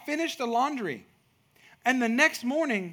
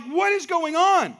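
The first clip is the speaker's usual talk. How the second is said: exasperated; 8 semitones higher, 6 dB louder, slower than usual. Retold or imitated. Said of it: "And you're like".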